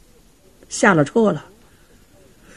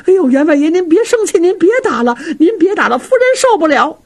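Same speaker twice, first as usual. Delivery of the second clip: raised, urgent pleading from the servants; frantic pleading cries; 8 semitones higher than usual. Acted. Maids begging the master to stop beating his wife, frantic pleading. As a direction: beg the master to stop, voices pitched up. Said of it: "只能在旁边喊呢".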